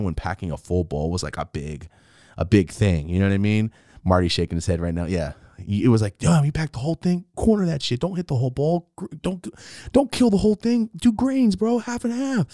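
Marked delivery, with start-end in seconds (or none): 6.2-12.5 high-pitched protesting friend; acted high-pitched alarm; urgent pleading. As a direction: fuss at them in a raised voice. Acting out friends guarding the bowl.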